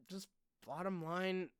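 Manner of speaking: ordinary talk, nothing marked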